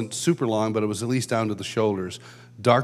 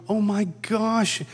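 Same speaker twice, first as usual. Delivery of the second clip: higher, slow marveling oh my gosh; awestruck; 8 semitones higher, slower than usual. Retold or imitated. Realizing the anger is really a huge smile, awestruck. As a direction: savor it in wonder. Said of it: "I'm going".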